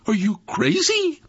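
high-pitched, disbelieving retort; incredulous. Acted. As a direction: throw it back in high-voiced disbelief. Friend's alarmed retort in story.

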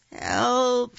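high, loud cry for help. A narrator's reading. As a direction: call out high and loud for rescue. Unseen voice calls again.

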